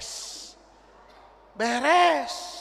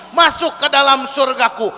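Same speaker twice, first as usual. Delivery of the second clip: usual speaking voice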